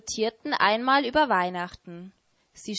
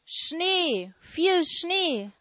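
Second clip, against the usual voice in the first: high, enraptured longing for snow; dreamy polar bear, raised pitch; rapturous polar bear; 10 semitones higher, much slower than usual. Acted. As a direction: swoon over the snow up high. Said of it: "voller Verzückung schwärmte er".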